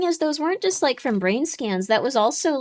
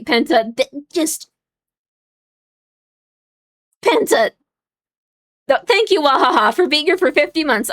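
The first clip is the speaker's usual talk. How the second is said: exasperated yelling; 2 semitones higher than usual. Real, not acted. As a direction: yell in disbelief at the chat. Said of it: "Sorry, I didn't mean to wake people up".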